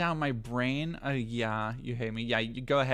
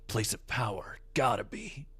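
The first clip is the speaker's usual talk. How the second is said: Solid Snake; 2 semitones lower, 5 dB quieter than usual. Retold or imitated. Slipping into Solid Snake while talking. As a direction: mutter it like a stealth soldier. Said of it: "slipped into Solid Snake".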